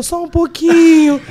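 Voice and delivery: tearful small voice. Retold or imitated. Colleague answering Josi on video call, tearful and slow. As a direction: play the crying colleague's timid answer.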